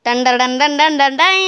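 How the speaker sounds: humming a little drum-roll fanfare; vocal fanfare. Pretend. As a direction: sing a mock fanfare sound. Imitated fanfare entering gate.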